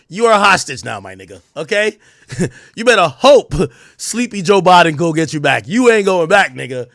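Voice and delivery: loud, high taunting captor voice; laughing over-the-top captor taunt; mock captor taunt. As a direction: gloat like a captor holding a hostage. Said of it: "they'd be like".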